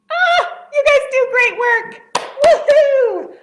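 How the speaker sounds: high, loud whoop of praise; excited cheering. Real, not acted. Teacher praises the children's singing, cheering loudly. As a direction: cheer them on at the top of your register.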